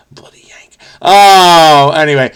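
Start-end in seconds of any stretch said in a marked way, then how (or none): 0.1-0.8 very soft, whispered self-scolding; muttered mock rebuke. Apologizing to UK friends for butchered accents, muttered mock rebuke. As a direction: mutter it under your breath.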